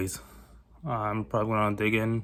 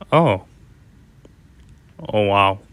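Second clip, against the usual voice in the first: surprised; 8 dB louder, slower than usual. Real, not acted. Sees surprising channel numbers.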